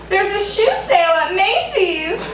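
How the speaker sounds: lightly voiced airy Kamala, slow and high; Kamala Harris impression. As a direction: toss it off like a breezy Kamala parody. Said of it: "and Kamala Harris is like".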